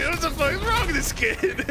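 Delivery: high, amused echo of exasperation; laughing mock outrage. Friend echoing an imagined exasperated dad, laughing mock outrage. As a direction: repeat it incredulously through laughter.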